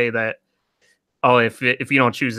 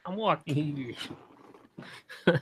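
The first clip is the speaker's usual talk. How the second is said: put-on soft, higher echo in a New York accent; 4 semitones higher, 11 dB quieter than usual. Co-host echoing the joke, hushed New York accent. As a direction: quietly copy the mock New Yorker. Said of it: "And saying the New York accent".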